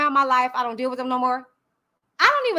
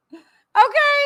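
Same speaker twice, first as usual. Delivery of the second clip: pointed okay; 9 semitones higher, 5 dB louder than usual. Real, not acted. Pointed challenge.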